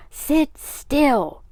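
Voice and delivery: high, loud, exasperated shout; angry shout. A narrator's reading. Father ordering son to stay seated, shouting. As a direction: yell the order, both words hard. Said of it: "shouted Mister Carmody".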